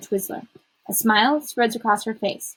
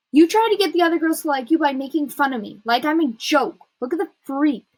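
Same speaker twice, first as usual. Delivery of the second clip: higher, heated reproach; angry accusation; 6 semitones higher, 4 dB louder than usual. A narrator's reading. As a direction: confront the friend who mocked you. Accusing friend of mockery.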